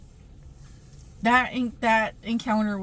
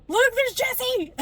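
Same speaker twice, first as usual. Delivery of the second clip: much higher, slow, excited fangirl call; fangirl squeal; 5 dB louder, slower than usual. Retold or imitated. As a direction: squeal his name like a starstruck fan. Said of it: "Like a, like a fangirl".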